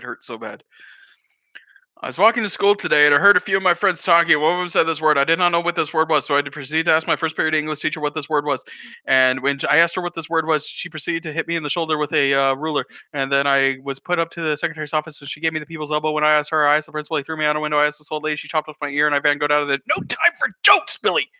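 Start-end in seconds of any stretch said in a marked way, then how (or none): 2.0-19.8 put-on fast, run-on kid's explanation; hurried, unbroken list of mishaps; breathless recounting. Acts Billy rattling off his day. 19.9-21.3 high, loud mock-dad snap; exasperated fatherly outburst; stern interruption. Acts dad cutting Billy off.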